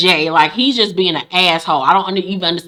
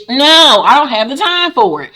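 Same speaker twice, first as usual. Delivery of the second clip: louder and higher on no; emphatic no; 5 semitones higher, 4 dB louder than usual. Real, not acted. Host refusing to hold a man down, loud emphatic no. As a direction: shut it down firmly.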